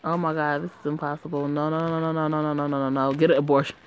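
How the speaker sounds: ordinary talk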